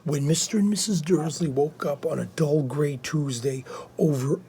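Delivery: quietly reading a story aloud; hushed reading. Acted. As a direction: read on softly so as not to disturb.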